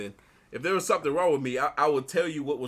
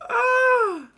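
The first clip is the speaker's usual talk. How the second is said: sigh after laughing; 12 dB louder than usual. Real, not acted.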